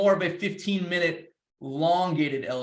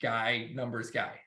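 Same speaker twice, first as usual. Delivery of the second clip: mock scary numbers guy; 5 semitones lower, 7 dB quieter than usual. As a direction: lower and softer, a mock ominous businessman. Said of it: "before I get into the scary".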